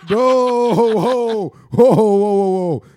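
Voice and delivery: booming ho ho ho laugh; loud laughter. Real, not acted. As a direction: laugh it out big. Bursts out laughing at cousin joke.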